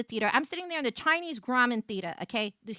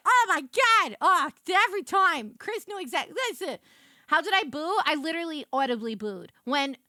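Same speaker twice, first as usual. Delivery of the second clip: ordinary delivery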